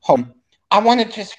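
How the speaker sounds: choppy, glitching connection; breaking up over a bad line; buffering stutter. Real, not acted. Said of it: "kinda got a choppy connection".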